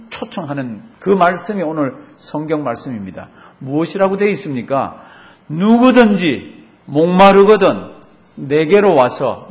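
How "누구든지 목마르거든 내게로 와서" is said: slow, loud, proclaiming; booming pulpit call; forceful preaching. Acted. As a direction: declare it to the whole congregation.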